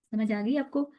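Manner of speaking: ordinary talk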